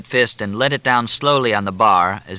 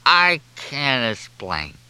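slow, drawn-out whine, raised pitch; tearful whine; 4 semitones higher, much slower than usual. A narrator's reading. Junior in tears at the bar, whining helplessly. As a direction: stretch it out plaintively. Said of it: "no whining voice, no quivering".

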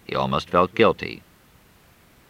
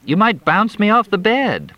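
high, louder complaint; complaining; 13 semitones higher, 4 dB louder than usual. Acted. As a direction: whine from the bumpy back seat. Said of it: "Crystal complained".

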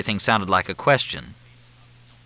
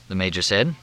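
plain reading voice

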